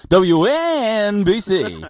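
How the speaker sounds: W N B C stretched slow and high, letter by letter; drawn-out call letters. Retold or imitated. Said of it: "teach him how to say it".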